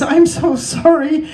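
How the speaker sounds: high, slow, tear-choked apology; hammed-up weeping friend; bursting into tears. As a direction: play it as a man breaking down in tears. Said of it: "he burst into tears".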